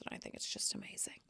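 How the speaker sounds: secretive whisper to the camera; whisper aside. Real, not acted. To viewers about separate bedrooms, a sly whisper. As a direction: let it out as a hushed secret.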